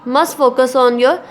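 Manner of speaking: normal talking voice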